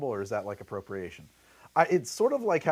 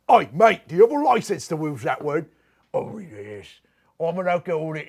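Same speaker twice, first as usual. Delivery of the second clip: hammed-up British lout; British accent, playfully confrontational; mock British accent; 5 semitones higher, 7 dB louder than usual. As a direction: put on a broad, comic British accent. Mock British exchange about saying mate.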